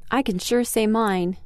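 plain reading voice

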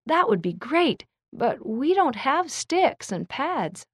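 put-on eager kid voice that slows on the snag; little brother. A narrator's reading. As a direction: pitch it up like a small kid. Little brother Ryan answers.

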